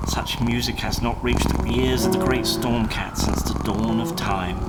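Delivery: usual speaking voice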